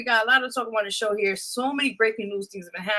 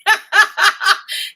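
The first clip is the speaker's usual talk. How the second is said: loud burst of laughter; 9 semitones higher, 8 dB louder than usual. Real, not acted. Host laughs loudly on air.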